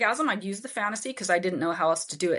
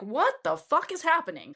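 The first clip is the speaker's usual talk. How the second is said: mock-baffled outburst, much higher; shocked disbelief; 9 semitones higher than usual. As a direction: pitch jumps high on a bewildered question. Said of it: "And I was like".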